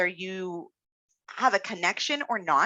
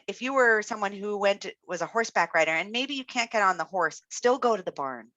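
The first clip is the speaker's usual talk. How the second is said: normal talking voice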